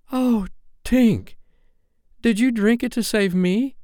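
high, anguished question; high-pitched boyish dismay; distressed boy. Acted. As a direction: cry out as the sacrifice dawns on you. Peter realizes Tink's sacrifice.

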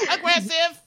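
very high, loud squeal; hammed-up shrieking accuser; shrill accusation. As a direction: shriek the accusation like a wounded diva. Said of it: "And Lee's like".

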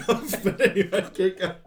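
laughing. Real, not acted. Laughing at own botched notes.

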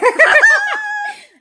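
very high, loud laughing squeal; excited squeal. Retold or imitated. Fan re-enacting her discovery in the office, delighted shrieking laugh. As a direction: shriek with fangirl glee. Fangirl outburst retold.

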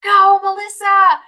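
high, loud drawn-out cheer from the stands; slow, stretched sideline yell; cheering dad. Acted. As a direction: holler like a proud parent at a game. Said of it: "my dad in the stands".

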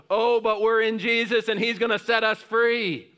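high, loud joyful cry of trust; joyful faith. Pretend. Believers cornered at the Red Sea, crying out high and loud in joy. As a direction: burst out with triumphant confidence. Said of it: "that we would go".

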